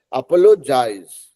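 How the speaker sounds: अपोलोजाइज spoken slowly, each syllable clear; slow apologize. Shown as a demonstration. Teacher calmly modelling अपोलोजाइज. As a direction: ease through अपोलोजाइज without rushing. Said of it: "थोड़ा आराम से देखिए".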